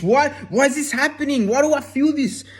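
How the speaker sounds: high, bewildered questioning; panicked inner voice. Retold or imitated. Viewer questioning their own feelings, voiced high and bewildered. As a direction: voice jumps up as if lost and confused. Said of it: "Are you like".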